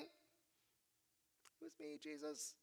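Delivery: very soft, very slow, barely voiced; timid, frightened admission; healed woman trembling as she confesses to Jesus; trembling confession. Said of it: "comes forward kind of trembling".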